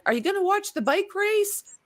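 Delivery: lightly voiced chirpy local, pitched high; quoting a local. Retelling a stranger's question to a friend, lightly mimicked. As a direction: ask it like an eager stranger, up high. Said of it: "they're like".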